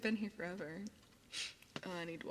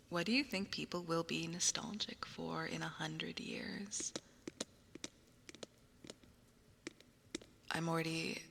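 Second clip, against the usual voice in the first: whispering. Acted. ASMR whisper.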